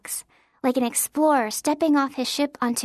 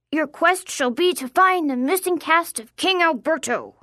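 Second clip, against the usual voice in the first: higher, lordly knight proclamation; mock deep knight; 4 semitones higher, 3 dB louder than usual. A narrator's reading. Boy sets his kneeling friend a quest, put-on grand knight voice. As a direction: announce the quest like a play-acting knight. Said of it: "he announced in his deepest voice".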